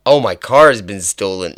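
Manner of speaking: loud, botched Canadian attempt; badly faked Canadian accent, punched out loudly; failed Canadian accent. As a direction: have another clumsy stab at sounding Canadian. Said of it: "I cannot do a Canadian accent".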